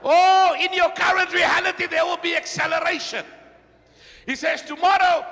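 high, loud prophetic proclamation; exultant preaching. Acted. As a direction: proclaim the promise to the whole church.

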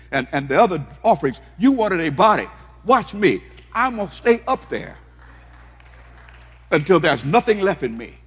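loud, high, building preacher's cry; fervent preaching. Acted. Preacher voicing Jesus vowing to stay on the cross, loud and high. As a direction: preach it with everything you've got.

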